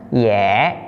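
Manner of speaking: "ye" as drawn-out yeh; slow "ye", rhyming with yeah; open e sound. Shown as a demonstration. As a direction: stretch "ye" with an open eh vowel. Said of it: "maka dibaca".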